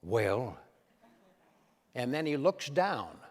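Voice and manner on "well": low, unhurried Reagan "Well"; a low Reagan-style "Well" before speaking; folksy presidential "Well"; Ronald Reagan's "Well". Retold or imitated. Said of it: "He goes".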